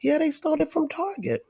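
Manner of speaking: put-on high little boy's complaint; upset grandson. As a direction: pitch up as the boy telling what happened.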